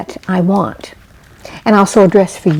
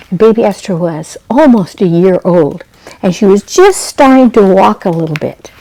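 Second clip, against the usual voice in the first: usual speaking voice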